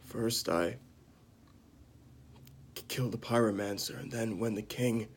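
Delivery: lightly acted grave confession, halting between phrases; acted Jaime Lannister. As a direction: recount the killing haltingly, with heavy pauses. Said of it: "I'm gonna perform it right now".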